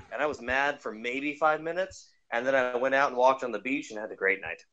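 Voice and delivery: ordinary delivery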